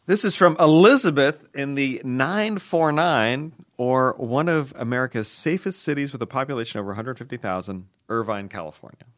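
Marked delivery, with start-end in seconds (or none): 0.1-6.2 email read aloud. Acted. Starts reading listener email.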